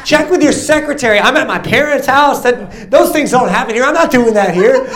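high, loud, comic protest; hammed-up pleading objection; arguing with God. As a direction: argue back with high-pitched, exasperated excuses. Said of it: "I was having an argument with God".

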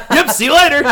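voiced high and loud while laughing; a laughing, breezy brush-off; laughing through it.

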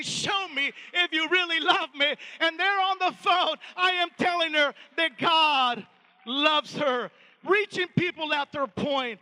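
loud, forceful declaration slowing on key words; big, emphatic pulpit peak; impassioned preaching climax. Real, not acted. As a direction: proclaim it to the whole church. Climax of testimony, preached loudly.

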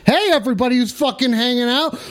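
ordinary delivery

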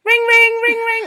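high, loud sung ring-ring; chirpy mock ring tone; phone ringing.

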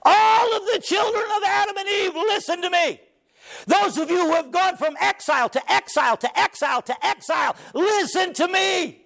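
high, crying shout over music; a savior's impassioned call to all; Jesus calling out. Acted. Jesus calls all the children of Adam, crying shout.

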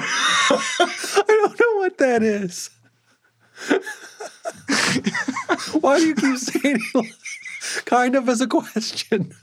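laughing hard. Real, not acted. Co-host cracking up at the bad Australian accent, laughing. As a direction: laugh through the whole question.

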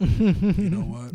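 very slow, high and loud; drawn out. Real, not acted. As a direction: stretch it out slowly. Drawn-out wrap-up.

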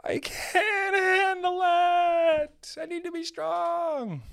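high, slow, whimpering fake cry; mock crying. Son pleading with mom over cinnamon buns, mock crying. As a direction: sob it in comic despair.

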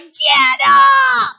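cheerleader yell. Retold or imitated. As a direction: yell at them to get back up. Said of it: "They're like".

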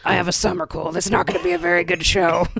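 lightly voiced metal frontman; a hint of a metal singer; Nathan Explosion voice. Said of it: "You're like Nathan Explosion from Metalocalypse".

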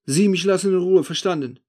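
loud, high indignant protest. A narrator's reading. The accused man telling the narrator off, indignant. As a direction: push back, outraged and loud. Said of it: "empört sich der Spießgeselle".

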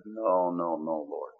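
soft, low refusal in prayer; hushed self-correction. Retold or imitated. Recoils from his own idea.

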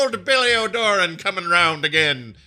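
a hint of Irish storyteller; lightly mock Irish. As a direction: spin a yarn about old Billy O'Doran in light Irish. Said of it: "How would they say it?".

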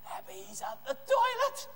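very high, slow mocking voice; drawn-out high-pitched tease; taunting punchline. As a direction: squeal out the cheeky jibe. Punchline of Elijah's taunt, laughter follows.

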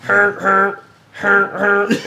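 high honking noise; imitated horn honk. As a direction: honk like a street vendor.

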